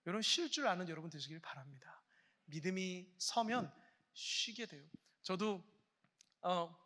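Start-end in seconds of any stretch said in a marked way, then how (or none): 0.1-2.0 whispered blessing, 쉴 leaned on, falling end; soft whisper. Real, not acted. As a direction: hush it like a secret blessing. Preacher whispers the blessing again. (속삭이는 축복)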